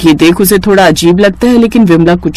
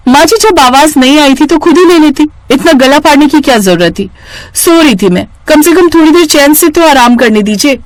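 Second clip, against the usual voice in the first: higher, sharp annoyed scolding; angry retort; 8 semitones higher than usual. Acted. Daughter-in-law scolding her mother-in-law for shouting, higher and annoyed. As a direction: snap back at her, pitched up.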